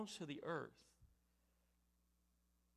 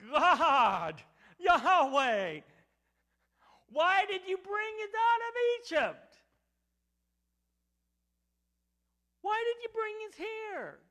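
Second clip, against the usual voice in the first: high, loud anguished plea to God; anguished cry; 12 semitones higher, 15 dB louder than usual. Acted. Joshua crying out to God in a high, loud anguished plea. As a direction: wail it out as a desperate leader.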